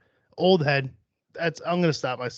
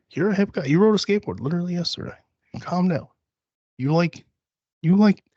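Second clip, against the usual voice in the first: higher, eager reassurance to yourself; mock pep talk; 4 semitones higher than usual. Host reassuring themself they're not an old man, mock encouraging. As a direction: hype yourself up in a coaxing voice.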